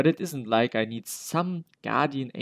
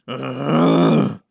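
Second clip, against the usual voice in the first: loud frustrated "ugh"; exasperated groan; 3 semitones lower, 10 dB louder than usual. Acted. Visitor refused at the doctor's door, loud exasperated groan.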